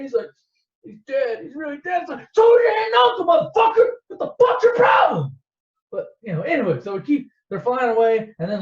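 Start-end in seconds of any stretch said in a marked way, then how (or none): 0.8-2.0 high-pitched blubbering imitation; mock crying. Luke crying to Leia on the ship, mock sobbing. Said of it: "He's on the ship crying about it". 2.3-5.4 very high, loud screamed rebuke; mock furious shout. Leia scolding crying Luke, furious shouting.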